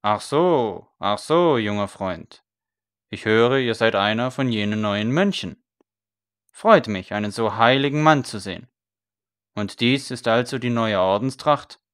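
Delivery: mocking priest. A narrator's reading. Priest teasing the scribe about his grey coat, mocking. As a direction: tease him as a holy man. Priest mocks the new monk.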